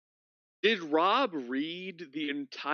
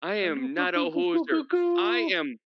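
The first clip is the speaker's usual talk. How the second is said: higher, louder protest against the teasing; indignant protest; 11 semitones higher, 5 dB louder than usual. Real, not acted. Friend denies being a hoser, loud indignant protest. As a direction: push back, offended but playful.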